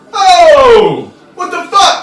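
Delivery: very high, loud indignant yell; startled, offended holler; miffed outburst. Acted. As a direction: shriek it like a waiter just splattered with beer. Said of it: "pretty miffed about this".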